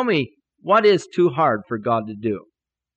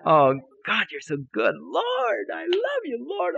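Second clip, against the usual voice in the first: high, whooping cries of adoration; ecstatic praise; 13 semitones higher, slower than usual. Retold or imitated. Preacher praising God for the check, ecstatic. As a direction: re-enact giddy praise, swooping way up. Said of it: "Oh, praising the Lord. You never heard such praises".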